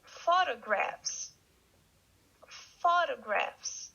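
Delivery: Photographs, slow, stress on the first syllable PHO; model pronunciation. Shown as a demonstration. Teacher shows how to pronounce Photographs. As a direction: say Photographs slowly for the learners. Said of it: "pronounce it like this".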